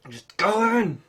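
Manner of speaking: loud, high, exasperated yell at the machine; frustrated shout. Real, not acted. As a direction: force it in with a loud cry. Yelling at the stuck part.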